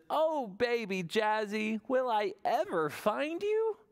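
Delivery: high, slow longing plea; mild mock worry. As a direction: sigh it out like a worried mother. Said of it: "And Mama Jojo says".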